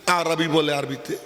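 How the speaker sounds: ordinary talk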